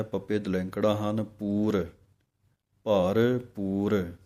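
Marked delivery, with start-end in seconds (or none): 2.8-4.1 ਭਰਪੂਰ drawn out slowly as one word with a clear p; slow model ਭਰਪੂਰ; slow careful word. Shown as a demonstration. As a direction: stretch ਭਰਪੂਰ slowly, p not bh. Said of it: "ਇਕੱਠਾ ਹੀ ਬੋਲਣਾ ਹੈ".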